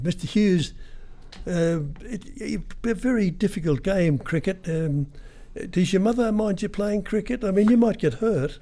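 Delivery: high, put-on silly voice. Prank caller teasing Kim Hughes on the phone, high silly voice. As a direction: ham it up as a cheeky prank caller. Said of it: "he was putting on a silly voice".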